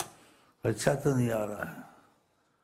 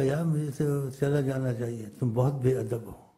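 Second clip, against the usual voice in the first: ordinary talk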